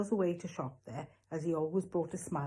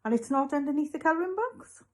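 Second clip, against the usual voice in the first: high, louder questioning while puzzling it out; slow, pondering; 10 semitones higher, 5 dB louder than usual. A narrator's reading. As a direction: ask it as if searching your memory. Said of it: "speaking extremely slowly".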